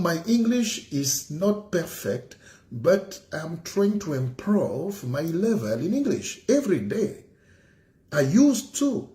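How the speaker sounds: slow, proud broken English; mock-grand English speech; pompous English. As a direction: show off your English grandly. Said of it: "Je vous ai dit perfectionniste".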